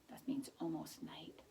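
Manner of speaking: hushed explaining aside; whispered aside. Real, not acted. Quiet aside explaining a word.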